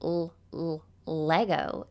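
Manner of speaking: quiet held "l-, l-" then "Lego"; lightly isolated L sound before "Lego". Shown as a demonstration. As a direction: hum the "l-" softly twice before "Lego". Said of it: "if I were to say the word Lego".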